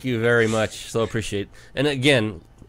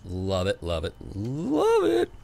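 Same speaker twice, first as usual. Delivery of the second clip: savouring approval; slower than usual. Real, not acted. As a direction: stretch the last one and swoop it way up. Host savours the guest's generous answer.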